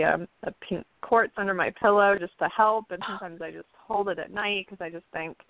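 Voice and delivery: ordinary talk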